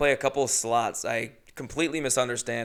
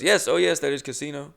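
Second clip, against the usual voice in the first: put-on loud, eager Indian-accented reply; Indian accent impression; 3 semitones higher, 4 dB louder than usual. Clerk pointing the comic to the casino, Indian accent. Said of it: "unfortunately, keep doing it".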